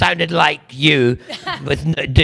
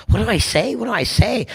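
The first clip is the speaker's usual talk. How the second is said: retold fluster; 8 semitones higher than usual. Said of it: "And I thought".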